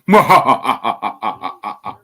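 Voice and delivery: mock villain's staccato chuckle; devilish laugh. Podcaster mimicking a fighter's announcement, devilish laugh. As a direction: imitate a drawn-out, devilish cackle. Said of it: "this little devilish laugh".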